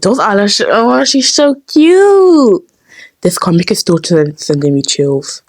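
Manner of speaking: normal talking voice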